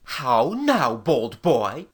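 low, lordly knight's challenge; haughty medieval lord, voice dropped. A narrator's reading. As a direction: challenge the boy as an affronted knight. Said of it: "asked Sir Wulfric haughtily".

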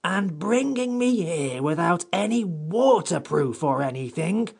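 high-pitched creature grumbling crossly. Acted. Samiad brought here without a waterproof, very cross. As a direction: complain peevishly in a high creature voice. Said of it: "said the Samiad still more crossly".

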